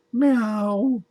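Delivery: a high meow; cat meow. Acted. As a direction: meow like a cat hiding in a sack. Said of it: "she says".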